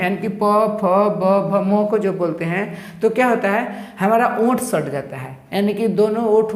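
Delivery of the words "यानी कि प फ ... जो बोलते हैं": प, फ, ब said very slowly with the lips pressed together; sounding out letters. Shown as a demonstration. Teacher slowly demonstrates lip consonants to the class. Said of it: "इसके वर्ग को जब हम बोलते हैं".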